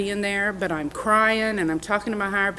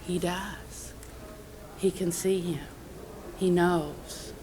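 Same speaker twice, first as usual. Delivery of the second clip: hushed inner voice; 8 dB quieter, slower than usual. Retold or imitated. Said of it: "I heard a voice that says".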